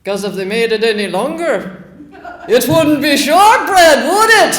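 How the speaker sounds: rising high and loud into laughter; laughing punchline. Retold or imitated. Dougie delivering the joke to the tourists, laughing and loud. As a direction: crack the joke and crack up.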